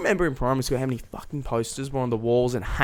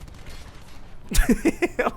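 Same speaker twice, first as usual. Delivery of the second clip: high airy unvoiced laugh; breathy laugh; 12 semitones higher, 3 dB quieter than usual. Real, not acted. Laughing at own joke.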